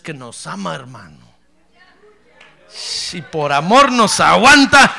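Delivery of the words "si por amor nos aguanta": loud, high shout of praise; fiery preacher shouting glory to God; preaching at full force. Real, not acted. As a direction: lift the church with a roar.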